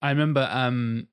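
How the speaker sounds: normal talking voice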